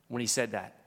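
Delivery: normal talking voice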